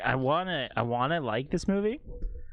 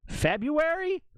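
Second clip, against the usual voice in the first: incredulous; slower than usual. Real, not acted. Surprised by the release date.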